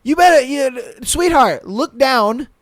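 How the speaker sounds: high, loud imitation of a scolding; slow, loud mock scolding; mock yelling. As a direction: yell it down at her, mocking. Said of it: "he kept yelling at me".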